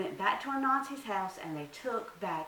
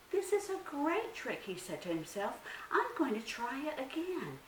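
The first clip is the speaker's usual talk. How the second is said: gleeful spider; 8 semitones higher than usual. Acted. Anansi gloats to himself.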